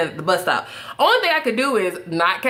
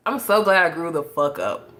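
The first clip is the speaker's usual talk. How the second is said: ordinary talk, nothing marked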